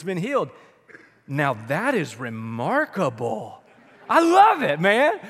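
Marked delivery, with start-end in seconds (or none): 1.3-3.6 sarcastic. Retold or imitated. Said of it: "gets sarcastic with him". 4.1-5.2 higher, louder, laughing; delighted laugh at the story. Real, not acted.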